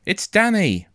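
high, loud call of one's name; shout. A narrator's reading. Boy telling his father who is calling, shouting. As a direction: call out so a lost father hears. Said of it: "I shouted".

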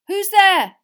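loud, high challenge; calling out. A narrator's reading. Harry calling into the dark wood, loud call. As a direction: shout it out into the distance. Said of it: "he called again".

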